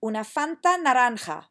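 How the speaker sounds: loud clear model phrase; teacher modelling drink name; model Spanish. Shown as a demonstration. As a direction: say naranja as naranxa, with a rasped j, for repeating. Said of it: "practice with me saying these things after me".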